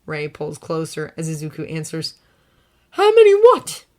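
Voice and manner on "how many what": confused. A narrator's reading. Izuku answers, confused.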